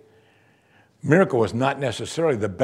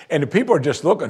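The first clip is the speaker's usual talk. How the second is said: ordinary talk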